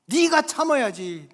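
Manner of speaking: lightly acted parent laying down the law; scolding parent. Parent scolding older son, acted. (꾸짖는 부모 말투)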